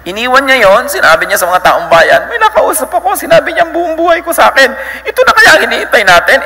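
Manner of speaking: high, excited retelling of the woman's news; excited townswoman. Retold or imitated. Woman telling the townspeople, high and excited. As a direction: burst out to the town with the news.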